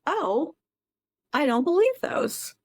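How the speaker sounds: high, incredulous oh and a doubting rise; inner disbelief. Retold or imitated. Therapist voicing a client's doubting inner voice, high and incredulous. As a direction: voice the part of you that won't buy it. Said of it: "Just notice".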